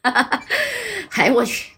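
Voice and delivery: slow, laughing through the words; drawn-out amused laugh while speaking. Acted. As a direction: crack up mid-line. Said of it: "给水房赖都给整乐了".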